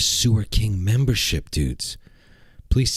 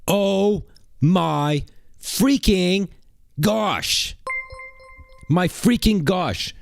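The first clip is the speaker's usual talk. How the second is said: slow, stretched, much higher oh my freaking gosh; overwhelmed excitement; 8 semitones higher, much slower than usual. Real, not acted. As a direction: drag it out in total awe. Reacting to fifty gifted memberships.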